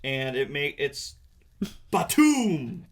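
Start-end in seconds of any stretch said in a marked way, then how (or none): 1.9-2.9 punchy "batum" boom; comic-book impact sound. Shown as a demonstration. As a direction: imitate gloves clashing with a big boom. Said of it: "what sound is, uh, is Doc Seismic's gloves making".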